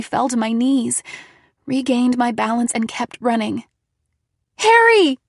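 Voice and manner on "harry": high, loud frantic yell; shout. A narrator's reading. Narrator shouts for Harry down the hall, frantic. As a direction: shout his name in panic. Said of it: "I shouted".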